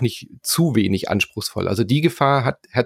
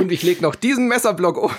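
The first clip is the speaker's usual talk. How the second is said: infomercial; 10 semitones higher than usual. Pretend. Teleshopping joke.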